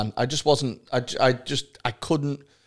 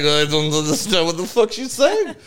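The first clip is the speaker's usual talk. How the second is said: put-on French accent, pitched up; 5 semitones higher, 4 dB louder than usual. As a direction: imitate a baffled French local, hammed-up accent. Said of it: "that's my French accent".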